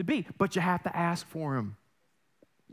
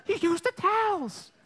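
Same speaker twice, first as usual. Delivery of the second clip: very high, mock-horrified mother voice; scolding mom; 13 semitones higher, 3 dB louder than usual. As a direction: imitate an appalled mom catching her kid. Mother scolding over used towels.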